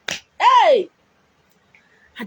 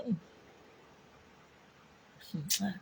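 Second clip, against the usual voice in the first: ordinary talk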